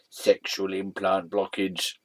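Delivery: ordinary talk, nothing marked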